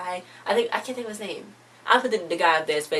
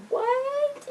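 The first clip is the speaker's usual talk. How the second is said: very high-pitched incredulous squeal; shocked disbelief. Retold or imitated. Vlogger reacting to the phone being thrown, shrilly incredulous. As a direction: shoot way up high in exaggerated disbelief.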